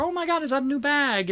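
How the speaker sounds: high, bubbly mock compliment; imitating gushing receptionist. Imitating the receptionist praising her scarf, high and gushing. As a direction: pitch it way up and gush. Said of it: "And so she's like".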